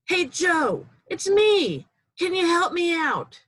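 high, loud call to a friend. Acted. Man in a hole calling his friend Joe, high and loud. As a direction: hail a passing friend from below.

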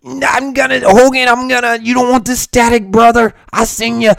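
hint of Macho Man Randy Savage snarling at Hogan; Macho Man impression. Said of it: "Savage sounds like Savage".

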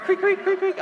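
playful high creak creak; cricket noise. Retold or imitated. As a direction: squeak out a cricket sound. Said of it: "I don't know".

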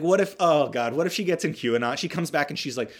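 ordinary talk

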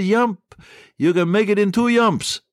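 put-on high-pitched, comic Norwegian-accented call; mock Norwegian shout. Sven urging Ole to leap aboard, mock shout. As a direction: urge him on from afar, without real volume. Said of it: "Sven shouted to Ole".

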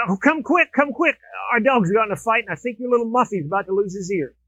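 high-pitched acted alarm, easing slightly; mock-urgent neighbor with news; urgent summons. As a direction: play a neighbor hurrying someone outside.

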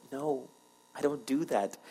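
hushed, measured denial; calm divine refusal. Acted. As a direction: answer quietly and firmly. God calmly answers the doubter.

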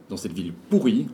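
ordinary delivery

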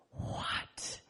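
breathless whispered what; awed whisper. Acted. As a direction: whisper it in wonder. Frog stunned by outside world.

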